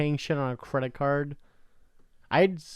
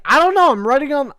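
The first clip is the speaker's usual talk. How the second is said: much higher and louder, flustered whine; mock flustered self; 12 semitones higher, 11 dB louder than usual. To the bill collector, a high flustered whine. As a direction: squeak out a frazzled excuse. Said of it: "this is me saying it".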